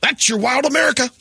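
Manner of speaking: high, grand announcer close; nature show host sign-off. Acted. Host closing the Wild America segment, grand high sign-off.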